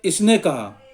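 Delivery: usual speaking voice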